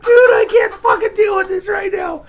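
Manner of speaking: high, loud retold exasperation; exasperated yell. Storyteller replaying his plea to his brawling friend, exasperated yell. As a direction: cry out like you're completely fed up.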